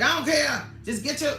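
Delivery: mimicking a parent brushing off a child; imitating harsh parent. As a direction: bark it like a fed-up parent. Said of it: "that's how they talk to their kids".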